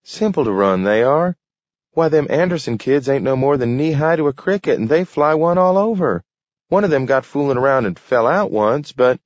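a wife pressing her husband with stories, fast and high; eager wife. A narrator's reading. Martha pushes her case.

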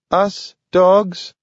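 a little dog making a solemn promise; pledging dog. A narrator's reading. Dog Nathaniel pledging to Grant, high and earnest. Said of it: "Nathaniel pledged".

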